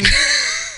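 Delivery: high laugh. Real, not acted. Laugh after rant.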